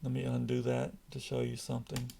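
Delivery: normal talking voice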